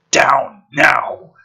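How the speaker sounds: urgent loud order; shouting. Acted. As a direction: bark it out. Ordering the kid down.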